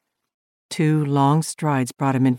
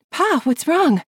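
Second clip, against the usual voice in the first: put-on high girlish voice, anxious; young girl's voice; 13 semitones higher, 4 dB louder than usual. Little girl asking her father what went wrong, high and anxious. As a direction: play a worried little girl calling out.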